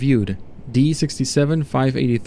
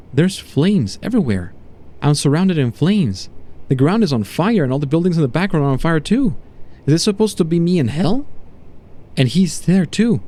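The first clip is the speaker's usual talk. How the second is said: distressed voice climbing higher toward the end; disturbed test subject; 4 semitones higher than usual. Acted. Subject to doctor describing flames in the painting, increasingly disturbed. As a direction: describe it with growing alarm.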